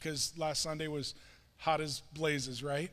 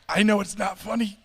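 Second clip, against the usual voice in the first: louder daughter voice about to crack up; imitating suppressed laughter; 3 semitones higher, 8 dB louder than usual. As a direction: mimic trying not to laugh. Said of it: "but also just laughing".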